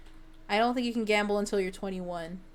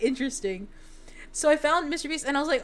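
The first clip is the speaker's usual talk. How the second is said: ordinary talk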